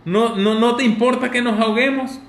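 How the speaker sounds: high, loud desperate shout. Acted. Disciples shouting at Jesus in the storm, loud and high. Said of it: "Y le gritaron".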